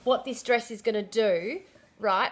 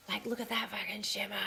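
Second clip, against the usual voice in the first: awed whisper; 3 semitones lower, 13 dB quieter than usual. Real, not acted. Showing off the shimmery dress.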